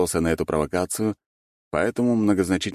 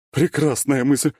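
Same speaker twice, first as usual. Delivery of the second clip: high, eager exclamation; joyful, pitched-up rallying cry; delighted; 10 semitones higher, 4 dB louder, slower than usual. A narrator's reading. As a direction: jump gladly at the idea. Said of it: "обрадовалась Зоя".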